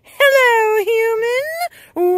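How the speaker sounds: high, loud, sweeping witch hello; grand witch greeting. Acted. Lilith greets the human, high and theatrical. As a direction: greet the mortal like a cackling sorceress.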